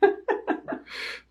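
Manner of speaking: breathy high-pitched laugh; quick airy giggle. Real, not acted. Laughs at own joke.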